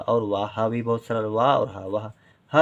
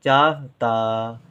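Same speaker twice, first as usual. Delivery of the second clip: slow sounding out; 3 dB louder, much slower than usual. Shown as a demonstration. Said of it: "teacher sounds out the word".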